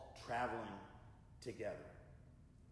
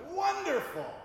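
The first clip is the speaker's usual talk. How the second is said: much higher, loud cry of delight; joyful shout; 9 dB louder than usual. Retold or imitated. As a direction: burst out with happy relief. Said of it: "the wise man shouted".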